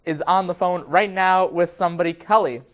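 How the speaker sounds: loud, lively telethon host. Pretend. Hosts a telethon segment.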